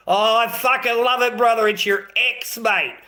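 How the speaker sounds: high, loud outburst of delight; loud gleeful excitement. Real, not acted. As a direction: crow loudly, pitched right up. Gloating over brother's ex.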